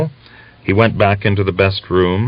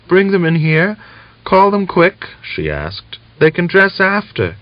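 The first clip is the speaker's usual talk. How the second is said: higher voice of a sick wife on the mend; relieved but frail, pitched up; weak young woman; 11 semitones higher than usual. A narrator's reading. Natalia asks for children.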